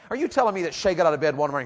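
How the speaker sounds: ordinary talk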